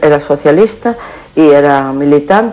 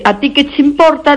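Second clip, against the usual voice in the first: higher-pitched quoted retort; neighbour's retort; 10 semitones higher than usual. Retold or imitated. Caller quoting what her neighbour told her, high-pitched retort. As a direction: lift the voice into the neighbour's snub. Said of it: "si a mí me dice".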